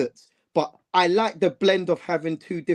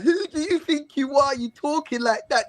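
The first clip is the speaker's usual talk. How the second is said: higher, half-laughing disbelief; laughing mock challenge; 8 semitones higher than usual. Fan retelling what he said to Gyökeres, high mock challenge. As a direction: tease him as if calling out his cheek. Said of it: "I said that to Gyökeres".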